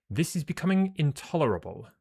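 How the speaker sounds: put-on high, indignant British accent; British-accented character. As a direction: exclaim it as an exasperated British officer. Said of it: "accent became that".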